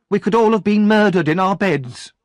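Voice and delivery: high, loud indignant woman; outraged and rattled after the fight; shaken shopkeeper's wife. Acted. Missus Sowerby, breathless and outraged about Oliver, high and loud.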